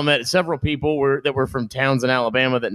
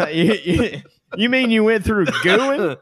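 laughing mock local; 6 semitones higher than usual. Mocking laughing correction.

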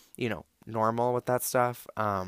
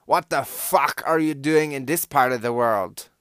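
louder, rough-edged stranger voice; imitating the Russian man; 4 semitones higher, 8 dB louder than usual. The drinking man asking why she's there, in a put-on louder voice. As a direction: demand it like a tipsy foreigner. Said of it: "And then he was like".

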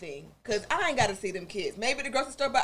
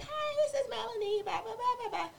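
put-on much higher, soft little-girl chirp; childhood recorder voice; 11 semitones higher, 9 dB quieter than usual. Little Melanie recording herself on a toy microphone, chirpy child voice. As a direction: imitate a kid into a toy recorder. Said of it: "it was like nails on chalkboard".

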